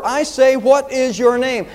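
high, slow angry demand; understated roar, each word drawn out; mild enraged roar. A narrator's reading. Emperor demands the soldier's name, mildly roared in rage. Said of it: "with a cry of rage, the emperor roared".

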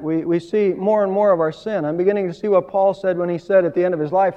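ordinary talk, nothing marked

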